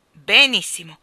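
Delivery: loud, grudging grunt from the king. A narrator's reading. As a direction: bite back at the cat with a grunt. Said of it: "grugnì il re". (grugnito del re)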